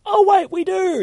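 high, loud burst of sudden remembering; squeaky-high excited exclamation; excited realization. Acted. Acted comic line, excited realization.

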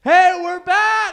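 loud excited greeting; shouting. Real, not acted. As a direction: yell it out, excited. Podcast return greeting shout.

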